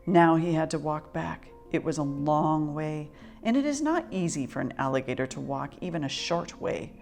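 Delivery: ordinary delivery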